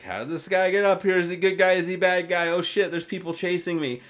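high, loud string of alarmed questions; acted-out alarm, loud and high-pitched; mock panicked bystanders. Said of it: "everybody's kinda like".

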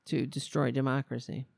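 normal talking voice

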